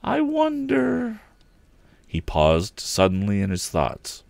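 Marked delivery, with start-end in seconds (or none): 0.0-1.2 musing. A narrator's reading. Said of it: "mused the youth".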